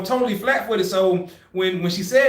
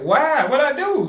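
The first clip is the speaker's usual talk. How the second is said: high, plaintive questioning; mock bewildered protest; 8 semitones higher, 3 dB louder, slower than usual. Recruit told he is going home, bewildered. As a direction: protest like a wronged kid. Acts out his protest to examiner.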